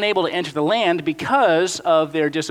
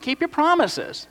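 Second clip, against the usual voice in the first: much higher, slow taunting; mocking Egyptians; 10 semitones higher than usual. Egyptians mocking Israel in the desert, slow high taunt. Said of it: "essentially, like".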